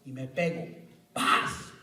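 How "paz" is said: sharp unvoiced whack; mock impact sound. Preacher imitating a hammer hitting his hand, mock impact. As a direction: slap the sound out.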